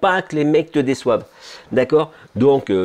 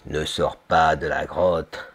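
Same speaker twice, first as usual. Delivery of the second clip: low, slow, looming warning; a cave-dweller's brain pleading, drawn out; 8 semitones lower, 4 dB quieter, slower than usual. Retold or imitated. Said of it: "il est là pour te protéger".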